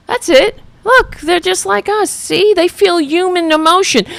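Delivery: high-pitched sarcastic pleading; mock earnest. Host parroting what Republicans want us to think, mock earnest. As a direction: sell it sarcastically, voice pitched well up.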